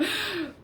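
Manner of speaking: short high-pitched giggle; a quick bright giggle. Real, not acted. Giggling to viewers about splashing it all over.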